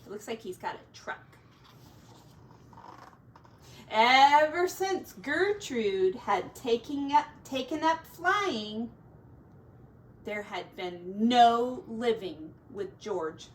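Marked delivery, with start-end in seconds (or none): 3.9-8.9 put-on reading to children. A narrator's reading. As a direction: read it out to the kids with bounce. Story narration read to children.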